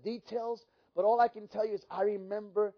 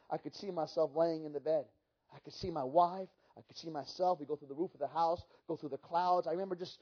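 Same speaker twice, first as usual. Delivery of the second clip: ordinary talk, nothing marked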